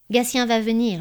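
ordinary delivery

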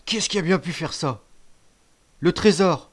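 high, loud groaning complaint; moaning outrage. A narrator's reading. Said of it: "râlait Grand Gibus".

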